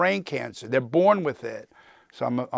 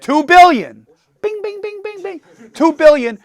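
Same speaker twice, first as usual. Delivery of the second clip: high, loud game-show cheer with bell dings; thrilled winner announcement; excited cheer; 11 dB louder than usual. Real, not acted. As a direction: ring it out like a quiz host hitting the winner bell. Audience member guesses the right answer.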